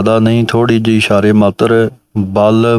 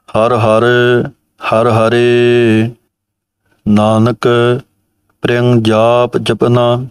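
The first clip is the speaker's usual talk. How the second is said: measured recitation; slower than usual. Shown as a demonstration. Reciting line.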